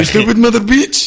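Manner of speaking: put-on high Indian accent insult. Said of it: "I have to do it in the Indian accent".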